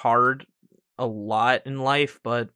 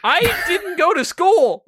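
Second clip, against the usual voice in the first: high squeaky voice; 5 dB louder, slower than usual. Real, not acted. Joking self-defense.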